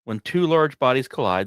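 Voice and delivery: ordinary talk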